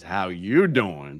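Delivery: slow, drawn-out sitcom pickup line; Joey from Friends. Acted. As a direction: stretch the catchphrase, swooping on YOU. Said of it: "that's Joey from Friends".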